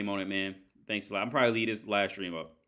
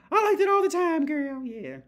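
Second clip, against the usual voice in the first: very high-pitched put-on girly voice; mock girly approval; 4 dB louder than usual. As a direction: squeal it out as a mocking imitation of a woman. Mock quote of celebrity fans' approval.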